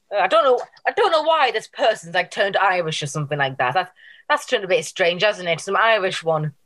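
normal talking voice

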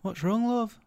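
put-on a wife asking in a mild Yorkshire accent. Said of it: "in a Yorkshire accent".

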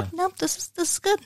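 soft contented. Retold or imitated. Content partner quietly approving.

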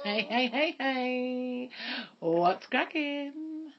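deliberately bad American accent, drawn out very slowly; whack American accent. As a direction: stretch out a crap American hello. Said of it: "I'm doing that whack American accent again".

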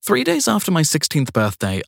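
plain reading voice

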